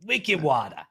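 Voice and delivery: badly faked Boston accent; hammed-up Boston attempt, falling away at the end. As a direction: have a clumsy go at Bostonian, dropping the R. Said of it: "I can't do a Boston accent".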